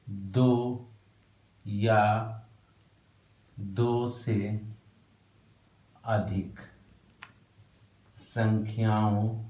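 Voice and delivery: slow dictation, word by word with long pauses. Shown as a demonstration. Teacher dictating notes word by word, slow and spaced. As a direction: dictate each word slowly so students can write. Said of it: "लिखो".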